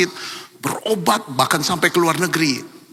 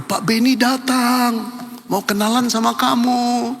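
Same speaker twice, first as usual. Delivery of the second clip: calling out; 8 semitones higher than usual. Retold or imitated. Wife calls up to her husband.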